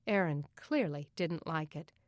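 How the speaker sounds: plain reading voice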